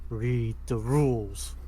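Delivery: slow, ominous disembodied voice; slow, drawn-out dark voice from nowhere. Acted. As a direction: intone it slowly like a dark presence. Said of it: "You just hear a dark voice going like".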